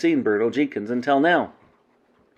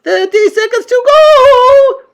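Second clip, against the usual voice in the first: very high, slow countdown screech; witch crying out; 12 dB louder, slower than usual. A narrator's reading. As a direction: call out the time like a shrieking witch. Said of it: "cried the Grand High Witch".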